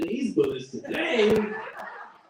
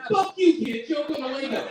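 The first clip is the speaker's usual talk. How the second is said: shouting; 9 semitones higher, 6 dB louder than usual. Retold or imitated. Angry retort to kid.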